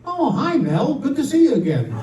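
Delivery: high-pitched parrot greeting; delighted parrot. Acted. Parrot to the husband at front door, delighted. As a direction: greet an old regular in a parrot voice. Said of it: "the parrot looks over and says".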